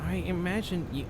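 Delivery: usual speaking voice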